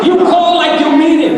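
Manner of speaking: high, loud exhortation; fervent preaching. Real, not acted. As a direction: urge the congregation hard.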